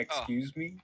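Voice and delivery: large man voice. Acted. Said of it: "I'm telling him".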